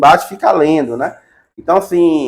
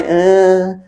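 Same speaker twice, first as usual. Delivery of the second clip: higher mock lazy "né, né, né"; teacher mocking a reluctant presenter, higher; unenthusiastic student; 5 semitones higher, much slower than usual. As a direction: parody a student with no will to present. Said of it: "pra vocês falarem".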